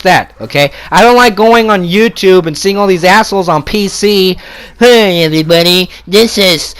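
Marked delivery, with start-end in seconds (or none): 4.8-6.7 a hint of a Puerto Rican accent on a YouTuber intro; mock Puerto Rican accent. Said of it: "with fucking little Puerto Rican accent".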